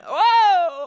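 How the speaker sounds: high loud startled whoa. Real, not acted. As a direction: yelp as the plate slips. Nearly drops breakfast.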